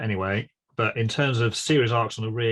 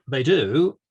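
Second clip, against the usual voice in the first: drawn-out, louder "they do" with a doubtful rise; hedging qualification; 3 semitones higher, 7 dB louder than usual. Real, not acted. Engineer qualifying his answer on ring circuits, hedging. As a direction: say "they do" as if a but is coming. Hedges the answer just given.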